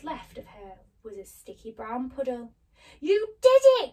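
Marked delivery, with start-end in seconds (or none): 3.0-3.9 high, loud cheer; jubilant cry. Acted. Lion cheers Dorothy's victory, crying out. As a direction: shout it high in triumph. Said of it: "cried the lion".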